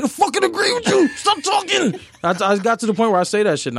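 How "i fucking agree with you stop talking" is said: high exasperated yell; husband cutting off his wife's sales pitch, high shout. Retold or imitated.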